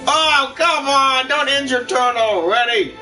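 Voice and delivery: usual speaking voice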